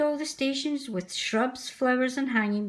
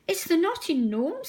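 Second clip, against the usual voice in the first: higher, a touch nervous; 7 semitones higher than usual. Acted. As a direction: say it timidly to the Fat Controller. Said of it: "said Percy nervously".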